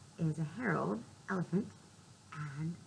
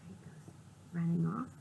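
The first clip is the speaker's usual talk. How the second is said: normal talking voice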